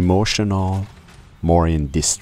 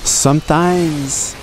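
drawn out; 11 semitones higher, 4 dB louder, much slower than usual. Acted. Drawn-out announcing opening word.

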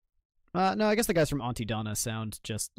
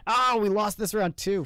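high, loud groan of defeat; loud dismay; 9 semitones higher, 6 dB louder than usual. Real, not acted. Streamer reacting to losing the round, loud dismay. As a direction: cry out at a lost round.

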